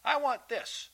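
put-on high, insistent kid's demand; demanding child. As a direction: demand it like a child who wants it now.

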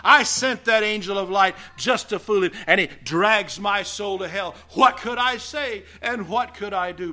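gloating devil. Retold or imitated. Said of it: "the devil would laugh".

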